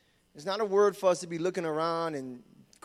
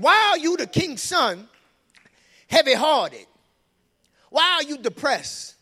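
high, loud, slow insinuating question; preaching at full force; 9 semitones higher, 9 dB louder than usual. Acted. Preacher acting Jonadab to the church, loud and high. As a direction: drive the question home to the crowd.

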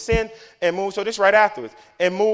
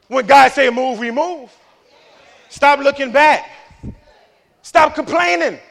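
high, loud preacher delivery; full-force preaching; 8 semitones higher, 9 dB louder than usual. Real, not acted. Preacher scolds people who keep looking back, full-force preaching. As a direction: drive it out to the whole congregation.

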